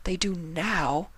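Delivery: reluctant and hurt; small answer. Acted. Octavia answers.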